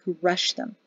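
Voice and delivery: plain reading voice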